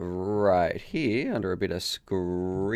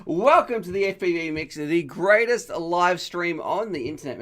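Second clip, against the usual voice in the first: high, bright-voiced announcer welcome; energetic host intro; 7 semitones higher than usual. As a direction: kick off the live stream like a show host. Stream host opening announcement.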